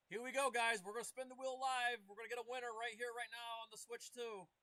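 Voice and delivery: hyped giveaway announcement. Real, not acted. Announcing live giveaway spin.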